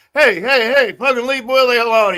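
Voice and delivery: loud, high mock protest; acted indignant defender. Stranger defends Willie from Tonic, imitated loud and indignant. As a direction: step in loudly, imitating an outraged bystander.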